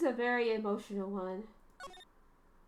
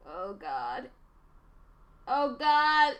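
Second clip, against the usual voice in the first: building dread; 2 semitones higher, 9 dB louder, much slower than usual. Real, not acted. Streamer dreading the emotional chapter, groaning louder each time. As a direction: dread rising with each repeat until it bursts out loud.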